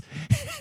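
a sudden high squeak of a laugh; squeaky laugh. Real, not acted. Cracking up over the cat, squeaky laugh. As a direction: let a squeal of laughter slip.

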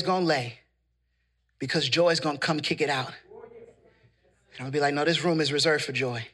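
low, soft prayerful sermon voice; hushed preaching. Real, not acted. Preacher bringing grief before the Lord, hushed preaching. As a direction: bring the sermon down to a quiet, weighted close.

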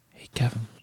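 hushed whisper, no voice at all; secret whisper. Retold or imitated. Writer secretly calls Kevin over.

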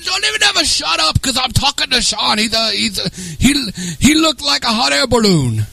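high caricature voice; high-pitched, halting impression of a buddy; Dennis. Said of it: "So go do Dennis".

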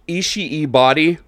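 normal talking voice

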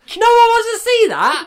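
high, slow teasing mimicry; mocking echo. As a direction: parrot her words back, teasing. Echoing her remark back mockingly.

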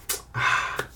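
breathy audible exhale; acted weary sigh; reluctant sigh. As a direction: sigh before turning someone down. Acted reply to client refusing price.

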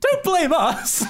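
squealed through laughter, very high; laughing. Real, not acted. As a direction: laugh out the words.

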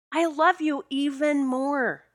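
slow, drawn-out, high-pitched gushing; emphatic adoration. Retold or imitated. Woman repeating her love for her soulmate, emphatic adoration. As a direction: stretch out each word adoringly.

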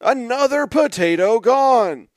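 slow, loud and high, like all caps. Retold or imitated. Host reading an all-caps tweet, slow loud declaration. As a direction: read it as if in capital letters. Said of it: "says in all caps".

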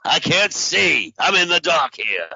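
N=Al Pacino/public; Pacino impression. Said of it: "Pacino being Pacino and getting angry".

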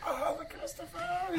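high, slow, crying cartoon voice; mock-sobbing cartoon helper. Cartoon helper told he has a new master, sobbing in a high voice. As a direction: wail out the name.

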